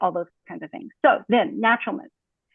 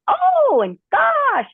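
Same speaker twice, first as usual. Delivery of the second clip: much higher, louder "Oh" and "Gosh"; filler exclamations; 6 dB louder, much slower than usual. Shown as a demonstration. Teacher demos regulatory fillers, high exclamations.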